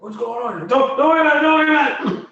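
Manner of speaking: very high, loud eerie spirit voice. Acted. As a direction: call out high and strange, like a spirit. Voicing a strange teleport voice.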